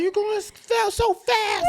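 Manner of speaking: high, loud, panicky pleading; scared imitation. Stepdad imitating the scared stepson, high panicky plea. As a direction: mimic a scared kid in a fast car. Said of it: "all scared".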